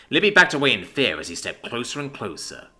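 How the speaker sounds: usual speaking voice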